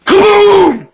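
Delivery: sudden huge high yell; explosive shout; shouted kaboom. Retold or imitated. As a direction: blast it out like an explosion. Old man pranks tube train.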